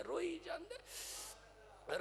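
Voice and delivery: high, soft, slow mock wail; imitating someone sobbing; acted weeping. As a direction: draw the words out into a thin acted cry.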